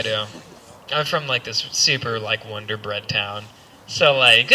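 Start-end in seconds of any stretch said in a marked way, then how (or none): none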